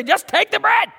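high-pitched exasperated outburst; annoyed neighbor. Acted. Neighbor gives in to the persistent knocker, annoyed. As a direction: give in irritably, voice jumping up high. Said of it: "even if it's because he's annoyed".

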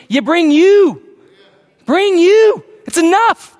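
high, loud emphatic preaching; rising to a high, loud climax; preaching shout. Real, not acted. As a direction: lift the church with it. Preacher rises to full force.

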